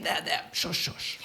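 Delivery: whispered shush to calm her; soft comforting whisper; soothing whisper. Acted. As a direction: hush her gently in a whisper. Comforting crying woman.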